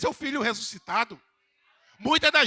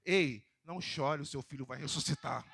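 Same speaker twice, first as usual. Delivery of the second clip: tender reassurance; 8 semitones lower, 6 dB quieter than usual. Shown as a demonstration. Preacher voicing Jesus gently consoling the widow, soft and tender. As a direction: gently comfort her, softer. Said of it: "Eu vou repetir de novo aqui".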